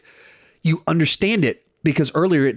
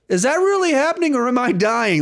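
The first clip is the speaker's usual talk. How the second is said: much higher, comic bewildered voice; dazed wounded hero; 11 semitones higher than usual. Retold or imitated. Stabbed Spider-Man staring at the torn sky, comically dazed. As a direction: play it wide-eyed and woozy for laughs. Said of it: "And he's like".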